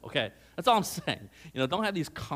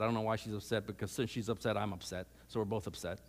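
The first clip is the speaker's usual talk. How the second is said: normal talking voice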